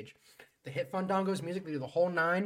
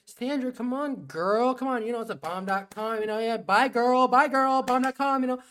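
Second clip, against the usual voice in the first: high mocking diva; 8 semitones higher, 7 dB louder than usual. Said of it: "It's like".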